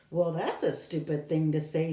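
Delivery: usual speaking voice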